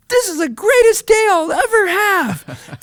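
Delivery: very high, bright sing-song affirmation; cheery mantra. Retold or imitated. Teaching the kid his morning mantra, high chirpy voice. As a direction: chirp it like a happy chant.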